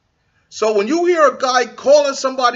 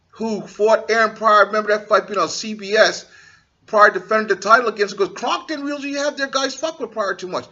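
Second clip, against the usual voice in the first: normal talking voice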